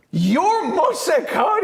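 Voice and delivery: high, slow, drawn-out shocked question; like he can't believe who's in his car; stunned disbelief. Retold or imitated. Driver recognizes the famous student.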